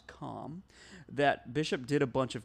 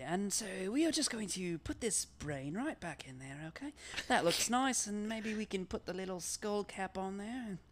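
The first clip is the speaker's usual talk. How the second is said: cheerful doctor voice; 6 semitones higher, 4 dB quieter than usual. Pretend. Playing the doctor in the scene.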